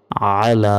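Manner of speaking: আলা with a pressed-throat আইন at the start; throaty ain. Shown as a demonstration. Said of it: "আইনের উচ্চারণ গলায় চাপ দিয়ে".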